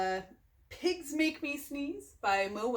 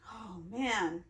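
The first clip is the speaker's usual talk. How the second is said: plain reading voice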